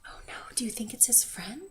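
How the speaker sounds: fast, hushed whisper. Real, not acted. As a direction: whisper it quickly, full of suspense. Reader hushed aside to child.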